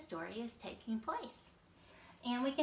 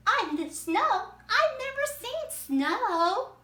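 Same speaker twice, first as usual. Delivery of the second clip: much higher, louder, slow and wide-eyed; excited alligator; 12 semitones higher, 11 dB louder, slower than usual. Acted. Alligator from Florida amazed by snow, high and loud. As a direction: marvel at snow you have never seen. Teacher voices Alvaro in drama.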